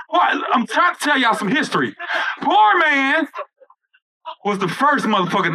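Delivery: usual speaking voice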